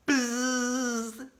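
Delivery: steady imitated buzzer noise. A narrator's reading. Reader imitates the quiz buzzer, steady buzz.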